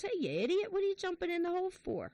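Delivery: high-pitched mock exasperation; exasperated scolding. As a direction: scold a friend in a joke, incredulous. Trapped man scolds friend in joke.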